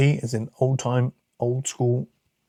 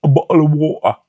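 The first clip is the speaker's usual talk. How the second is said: loud mock-British catchphrase, pitched higher; exaggerated British accent; 4 semitones higher, 11 dB louder than usual. Shown as a demonstration. Briton mocking how people say we speak, exaggerated British accent. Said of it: "that's not how we speak".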